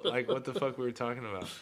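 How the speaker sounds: normal talking voice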